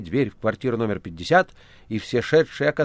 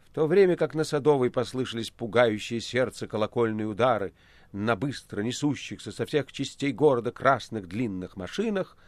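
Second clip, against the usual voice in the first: plain reading voice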